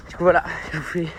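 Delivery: talking while running; puffing between words on a run; while jogging. Real, not acted. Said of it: "je vous parle en courant".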